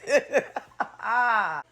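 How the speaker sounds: a falling burst of laughter; real laugh. Real, not acted. Vlogger laughing at the screenshot drama.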